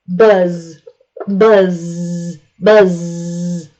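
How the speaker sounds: "buzz" with a long, buzzing voiced Z. Shown as a demonstration. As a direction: stretch the Z in "buzz" so it vibrates. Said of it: "The Z is a voiced sound".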